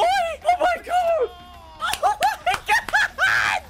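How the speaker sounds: high, loud, slow stretched shouts breaking into laughter; ecstatic shout. Real, not acted. Fan seeing Joy Boy on screen, stretched ecstatic shouts. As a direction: let it burst out of you in disbelief.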